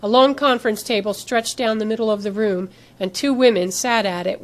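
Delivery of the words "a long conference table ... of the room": usual speaking voice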